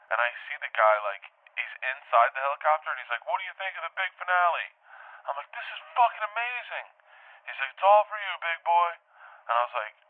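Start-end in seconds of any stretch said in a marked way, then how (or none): 3.3-4.7 high-pitched call. Retold or imitated. Pilot to dreamer under the helicopter, calling out. 5.5-6.9 high, thrilled cry; acted elation. Dreamer to pilot while hanging under helicopter, acted elation. 7.7-8.9 high, raised-voice call. Retold or imitated. Pilot to dreamer below the helicopter, calling out.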